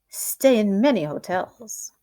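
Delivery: ordinary delivery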